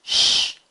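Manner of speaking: a long loud hissed "Sh"; loud drawn-out sh; sh sound. Shown as a demonstration. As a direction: push the "Sh" out hard. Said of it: "yo se los voy a decir".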